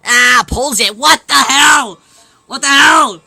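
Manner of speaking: loud, high indignant yell; hurt tooth, loud mock outrage.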